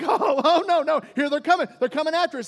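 loud, high-pitched hammed-up alarm; mock alarm. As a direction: act out panic at the approaching army. Acting Israelites seeing the army approach.

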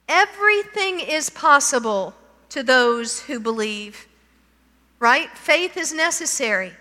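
normal talking voice

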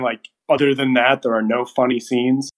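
usual speaking voice

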